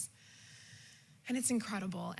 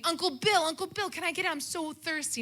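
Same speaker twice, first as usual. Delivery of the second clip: put-on high, loud little-kid begging; pleading child voice asking for a soda; childish plea; 7 semitones higher, 4 dB louder than usual. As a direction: whine up at the uncle for a soda. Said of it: "So I'm saying".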